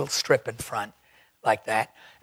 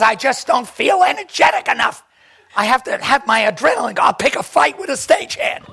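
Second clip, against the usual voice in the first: louder, rushed and a touch laughing; loud, fast declaration; 6 semitones higher, 4 dB louder than usual. Retold or imitated. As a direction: pump yourself up out loud. Melissa quoted, loud and fast.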